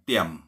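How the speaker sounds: "tiam" low, with an unaspirated t; low falling "tiam"; tone for "quiet". Shown as a demonstration. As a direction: say "tiam" low and short, no puff of air. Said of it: "Intonasinya ya".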